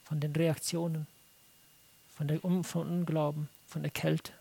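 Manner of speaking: soft, low, earnest plea to God; hushed, low, heartfelt prayer; longing prayer. Retold or imitated. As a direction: pray it with deep, quiet longing. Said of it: "mit diesem tiefen Verlangen".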